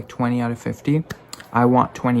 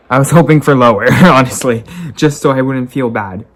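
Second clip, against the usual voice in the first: laughing; 10 dB louder than usual. Real, not acted. Laughing at her grade.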